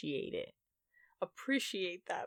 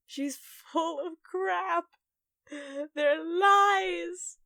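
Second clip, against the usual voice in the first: higher and much louder, slow; emphatic outburst; 8 semitones higher, 9 dB louder, much slower than usual. Real, not acted. Calling out her mother's carrot myth, loud outburst. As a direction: blast the punchline.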